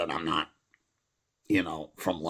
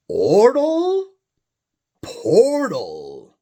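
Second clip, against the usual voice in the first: mock rhyming squeak; 9 dB louder, much slower than usual. Silly rhyme play mid-poem.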